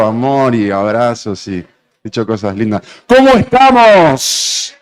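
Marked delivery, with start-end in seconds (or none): 3.1-4.7 slow, high, loud call to the room; rally the audience; calling out to the crowd. Real, not acted. Host calls to the audience again.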